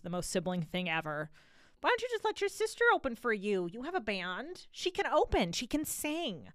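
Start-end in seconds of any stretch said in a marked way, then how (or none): 1.8-6.5 high-pitched, wheedling mock voice; mock naggy parent, pitched way up; imitated nagging relative. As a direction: plead the sister's case in a high put-on voice. Voices a pushy family member.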